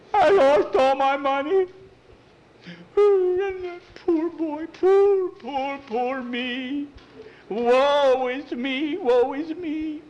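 high, slow, loud mock lament; overacted woe-is-me crying; hammed-up wailing. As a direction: wail it like a tragic beggar boy. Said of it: "What are you crying about?".

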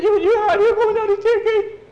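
stammered high shriek; very loud, high-pitched outburst; quarrelling. Acted. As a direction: yell it at the top of your voice. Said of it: "Now we're fighting".

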